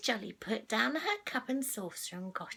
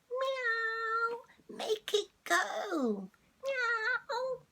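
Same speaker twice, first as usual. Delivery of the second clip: high meowing cat urging; 11 semitones higher, much slower than usual. Acted. Ginger cat urging Missus Jolly, high mewing. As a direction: mew eagerly like a cat. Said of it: "my cat voice".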